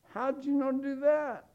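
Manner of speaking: high, amazed squeal of disbelief. Retold or imitated. High squeal asking a gifted classmate how they knew. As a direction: ask it like they just did magic. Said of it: "And you're like".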